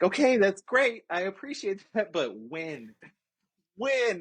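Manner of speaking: high, loud and pleading for when; high exasperated demand. Retold or imitated. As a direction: push her, high and impatient, for when. Retelling impatient demand to recruiter.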